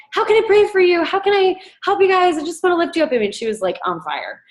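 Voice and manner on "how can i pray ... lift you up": high, louder gushing imitation; eager and caring. Bethany offering prayer to the group, imitated high and eager. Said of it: "And she's just like".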